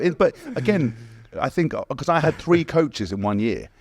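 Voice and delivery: usual speaking voice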